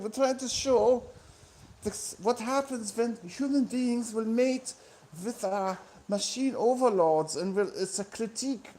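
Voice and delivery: mock East German techno artist; German accent. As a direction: explain it earnestly in a comic German accent. Impersonates an East German techno act.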